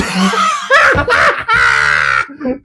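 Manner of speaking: high wheezing laugh; crying with laughter. Real, not acted. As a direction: laugh till you cry. Helpless laughter.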